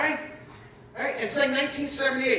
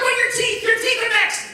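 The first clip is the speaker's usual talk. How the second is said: higher, louder teasing jab; mock jab; 12 semitones higher, 5 dB louder than usual. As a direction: needle him loudly for laughs. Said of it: "I'm like".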